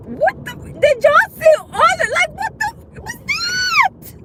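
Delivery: shrieking, squealed disbelief; high-pitched shock. Real, not acted. Driver reacting to the road, high shocked squeal. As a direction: let the voice shoot way up in alarm.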